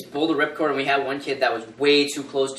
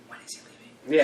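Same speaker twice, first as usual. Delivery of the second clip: under-breath quip; 7 dB quieter, much faster than usual. Retold or imitated. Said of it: "It's like".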